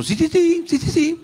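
high mocking gossip voice; mock her like a snide host; Pati Chapoy. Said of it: "Pati Chapoy dijo".

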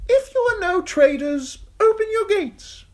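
high, loud knight's call. A narrator's reading. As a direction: call up to the gate. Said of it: "Sir Amory called".

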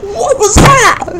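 very high, slow disbelieving squeal; drawn-out high shocked cry; stunned shriek. Real, not acted. Stunned by strange event.

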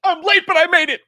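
manic, very high shouted impression; frantic comic shout at the top of the voice; Jim Carrey. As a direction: yell it way up high, hammed up. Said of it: "Jim Carrey voice".

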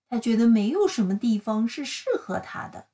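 usual speaking voice

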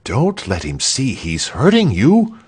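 plain reading voice

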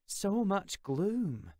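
a weary, fond sigh. A narrator's reading. Claire sighs at the gloomy boy, slow and weary. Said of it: "she sighed".

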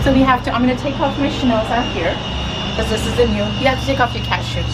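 ordinary delivery

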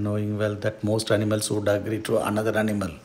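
usual speaking voice

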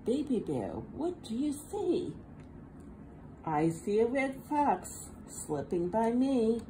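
ordinary delivery, nothing marked

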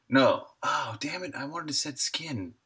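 usual speaking voice

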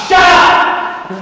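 angry shout. Acted. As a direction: bark it out hard and loud. Officer snaps at his men.

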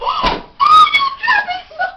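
sudden high yell; alarmed shout. Real, not acted. Phone dropped, yelling out.

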